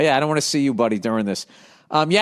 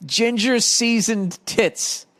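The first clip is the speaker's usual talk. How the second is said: slow and higher-pitched; savoured repeat; 6 semitones higher, much slower than usual. Real, not acted. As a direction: draw each word out, pitched up. Savouring the nickname after laughing.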